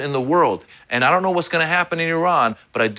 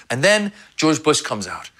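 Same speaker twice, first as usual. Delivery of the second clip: ordinary delivery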